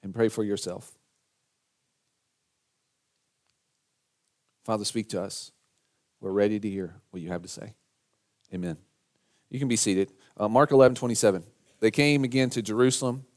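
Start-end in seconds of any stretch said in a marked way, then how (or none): 0.0-8.8 low-key, softer prayerful voice; quiet, reverent pastor's prayer; hushed prayer. Real, not acted. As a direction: lead the room gently in prayer, with long pauses. Leads congregation in prayer.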